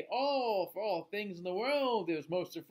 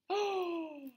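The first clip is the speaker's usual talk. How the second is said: high startled gasp; 12 semitones higher than usual. Acted. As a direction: gasp at the twist. Storyteller gasps before twist.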